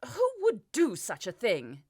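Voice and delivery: near-hysterical king, voice cracking. A narrator's reading. King panicking to his spymaster, cracking voice. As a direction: pitch up and teeter on panic. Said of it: "in a voice that cracked on the border of hysteria".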